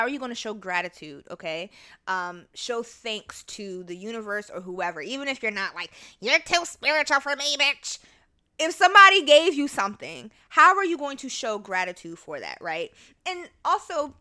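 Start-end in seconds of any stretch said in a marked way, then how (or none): 6.2-8.0 louder, higher sassy put-on voice; mock dismissive. Speaker voicing someone who isn't spiritual, mock dismissive. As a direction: throw it out as a loud mock brush-off.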